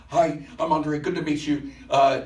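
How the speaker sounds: high, flustered greeter; imitating a frazzled young woman; stressed host voice. He mimics the stressed greeter, high and flustered.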